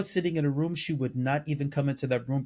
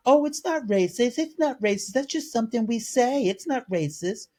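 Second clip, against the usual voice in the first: mock dismissive girl; 7 semitones higher than usual. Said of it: "the girl was like".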